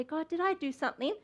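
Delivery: soft, sincere question to God; hushed, honest asking; genuine questioning. Acted. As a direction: ask it gently and in earnest. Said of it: "with genuine intent to say".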